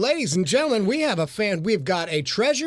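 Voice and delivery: lilting home-shopping presenter patter; hammed-up shopping channel pitch; QVC host. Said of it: "welcome to the Q V C channel".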